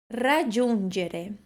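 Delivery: "Raggiungere" slow, rolled r and j as dj; trilled Italian R. Shown as a demonstration. Teacher introduces the twelfth word, careful trilled pronunciation. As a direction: roll the start of "Raggiungere". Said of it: "é um R tremido".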